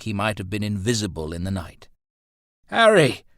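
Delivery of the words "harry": high, loud cry of recognition; exclaiming. A narrator's reading. Roland recognising his friend in the dark, exclaiming. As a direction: shout the name in relief. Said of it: "Roland exclaimed".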